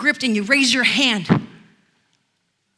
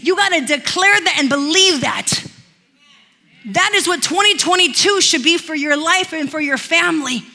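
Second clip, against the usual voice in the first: preaching at full force; 4 semitones higher than usual. Acted. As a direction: build the call into a fiery exhortation. Pastor exhorts congregation to declare.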